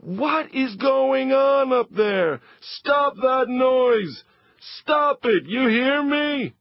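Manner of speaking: high, loud, slow shout of an angry father; angry yell. Acted. Papa yelling up at Leo in the attic, high and loud. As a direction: bellow it up the stairs, fed up.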